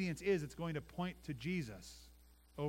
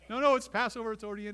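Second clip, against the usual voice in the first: higher, louder hammed-up protest; mock flustered parent; 6 semitones higher, 9 dB louder, faster than usual. Pastor playing panicked parent at Passover, mock frantic. Voicing parent mid-scene.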